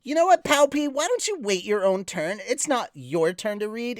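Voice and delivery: high, sassy scolding. Pretend. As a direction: tell them off with attitude. Scolds co-host for interrupting.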